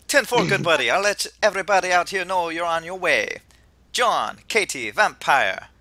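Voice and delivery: loud, animated Jamaican professor; attempted Jamaican accent. Host as professor cheers players on their way, Jamaican accent. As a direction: holler it with a hammy Jamaican accent. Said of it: "I can't do a Jamaican accent".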